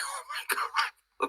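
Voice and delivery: breathless stunned whisper of disbelief. Retold or imitated. Whispering in awe after the archangel's message. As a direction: hush it out, floored by the news.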